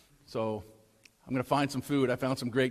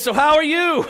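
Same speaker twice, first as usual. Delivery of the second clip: high, loud, chirpy cashier greeting; bright mock cashier hello; cheery cashier; 12 semitones higher, 11 dB louder than usual. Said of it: "She says".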